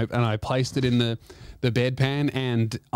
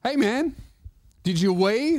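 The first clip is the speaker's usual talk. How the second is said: higher, lightly imitated nurse's check-in; a hint of the night nurse, pitched up; 7 semitones higher than usual. Night nurse waking the patient, lightly imitated higher voice. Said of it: "he goes".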